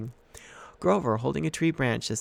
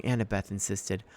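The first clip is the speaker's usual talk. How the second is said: ordinary delivery, nothing marked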